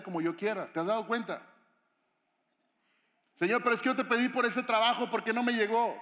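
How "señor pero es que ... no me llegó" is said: raised, pleading protest; complaining. Acted. Believer asking God why the job never came, complaining. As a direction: push back in a higher, aggrieved voice.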